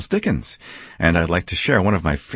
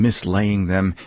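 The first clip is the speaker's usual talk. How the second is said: ordinary delivery, nothing marked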